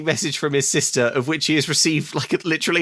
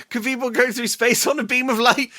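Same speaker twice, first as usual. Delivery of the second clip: put-on higher, breathless childish question; mock eager Zoe; 9 semitones higher than usual. Host mocking Zoe's breathless question, higher and eager. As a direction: ask it like an overexcited kid. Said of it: "It's like".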